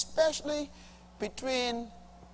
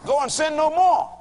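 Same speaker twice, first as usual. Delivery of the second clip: higher, louder ringing command; proclaiming God's command; 10 semitones higher, 8 dB louder than usual. Retold or imitated. Preacher repeating God's command to the sinner, loud and ringing. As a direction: sound it out like a verdict. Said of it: "Next statement".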